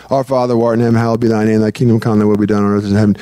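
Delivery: low monotone congregational recitation; droning Our Father, mechanically recited; insincere rote prayer. Retold or imitated. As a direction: rattle it off by rote, all on one low note. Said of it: "we should pray like they do".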